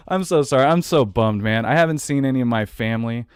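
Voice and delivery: ordinary talk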